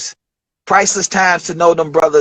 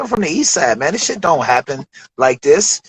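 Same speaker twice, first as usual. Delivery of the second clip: ordinary talk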